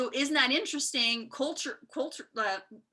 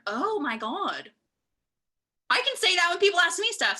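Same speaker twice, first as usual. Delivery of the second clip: excited discovery; 7 semitones higher, 4 dB louder than usual. Retold or imitated. Thrilled by her own discovery.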